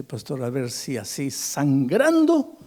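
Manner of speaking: imitated desperate plea, slow, swelling high and loud; mock weeping plea without real sobs; retold tearful plea. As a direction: plead slowly as the weeping man, building to a loud peak. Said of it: "llorando ese pobre hombre me dice".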